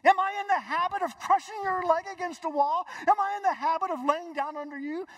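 high-pitched, insistent questioning; indignant talking donkey. Acted. Donkey protests to its rider, high and indignant.

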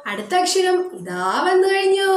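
normal talking voice

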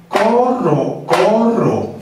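"Corro" en dos sílabas iguales y alargadas; co-rro, co-rro sobre el pulso; corcheas recitadas. Shown as a demonstration. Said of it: "Entonces esto va a sonar". (recita cada "corro" en un tiempo)

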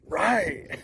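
ordinary delivery

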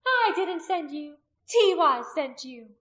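high, slow, loud rebuttal; angry denial. A narrator's reading. Priestess denying the warrior's accusation, high and angry. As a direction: throw the charge back forcefully.

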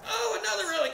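high, alarmed storyteller's yelp; re-enacted high-pitched alarm; acted panic. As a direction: cry out as the next hit comes. Reliving railing hits while screaming.

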